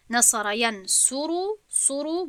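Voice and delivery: ینصر stretched slowly on its "su", then "suru" repeated; stress on the damma. Shown as a demonstration. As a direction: draw out the u in ینصر, syllable by syllable. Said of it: "با ضمه".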